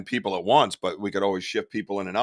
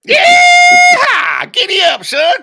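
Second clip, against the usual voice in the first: very high, booming cowboy whoop; hammed-up Southern holler; 15 dB louder, much slower than usual. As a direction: holler it like a mock rodeo cowboy. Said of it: "you do that pretty damn good".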